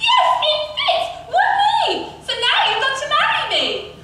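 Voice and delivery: very high, loud triumphant screech; ugly sister's high, whooping scream. A narrator's reading. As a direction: shriek it in gloating delight. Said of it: "But she screamed".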